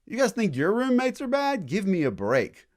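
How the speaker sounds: high, loud sarcastic cheer; British announcing quartered soldiers, sarcastic cheer; mock cheerful British. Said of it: "So they said".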